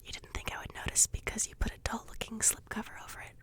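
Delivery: hushed unvoiced whisper throughout; library whisper. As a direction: keep it all in a library whisper.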